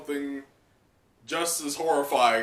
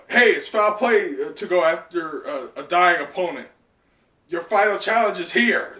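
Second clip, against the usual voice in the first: loud taunt; 4 semitones higher, 6 dB louder than usual. Acted. Villain taunts a dying opponent.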